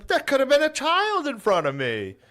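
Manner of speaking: high piercing imitation of an old woman scolding; scolding old lady. Old woman at his car window, high mock scolding. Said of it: "she was standing outside of my car like".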